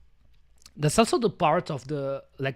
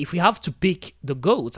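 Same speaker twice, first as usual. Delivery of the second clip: usual speaking voice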